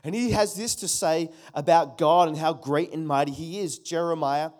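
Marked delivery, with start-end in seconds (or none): none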